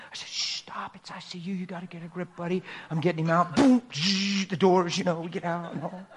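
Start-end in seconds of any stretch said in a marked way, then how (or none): 0.3-2.6 soft hushed warning; shushing. Retold or imitated. Father hushing his son in the ICU, softly. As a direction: hush him quietly in the ward.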